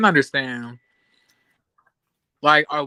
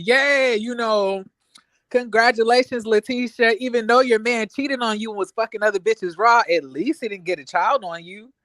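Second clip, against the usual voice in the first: sarcastic congratulations; 6 semitones higher, 3 dB louder than usual. Retold or imitated. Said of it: "I was like".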